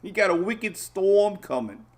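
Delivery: drawn-out Boston wicked storm, playful; Boston accent. Retold or imitated. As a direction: tease a Cape Cod friend in a mock Boston accent. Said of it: "what do they call it up there?".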